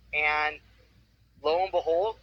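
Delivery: ordinary talk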